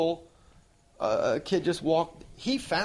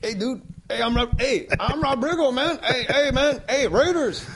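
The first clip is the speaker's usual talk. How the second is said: loud, high, boisterous hailing; hammed-up bro greeting the guys at full volume; Rob Riggle; 8 semitones higher, 5 dB louder than usual. Impression of Riggle hailing his buddies.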